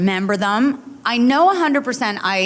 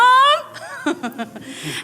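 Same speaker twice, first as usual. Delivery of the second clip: squealing burst of laughter; high laugh. Real, not acted.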